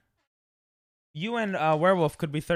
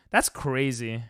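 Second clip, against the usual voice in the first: usual speaking voice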